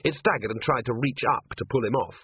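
ordinary delivery

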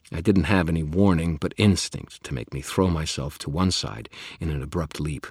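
plain reading voice

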